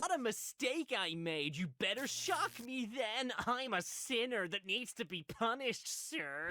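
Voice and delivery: put-on high, breathy, overeager kid voice; high eager child voice. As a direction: play the kid begging for punishment. Voicing the child on the game.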